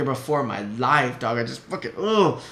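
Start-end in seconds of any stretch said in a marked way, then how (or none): 2.0-2.4 high exasperated growl of frustration; angry groan. Real, not acted. Brother reliving that night, angry groan. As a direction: let out pent-up rage without words. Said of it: "it makes me mad just thinking about it".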